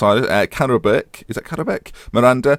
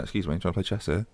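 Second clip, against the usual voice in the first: hushed monkey voice; 2 semitones lower, 11 dB quieter than usual. Retold or imitated. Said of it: "monkeys have turned around and gone".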